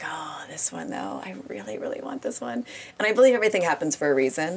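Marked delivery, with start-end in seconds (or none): 0.0-2.7 whispering. Retold or imitated. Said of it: "You're like".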